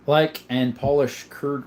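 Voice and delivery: normal talking voice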